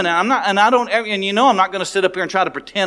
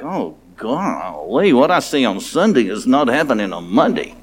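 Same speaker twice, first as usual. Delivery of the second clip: dismayed aside; 7 semitones lower than usual. Retold or imitated. Dismayed inner thought about faking churchgoers.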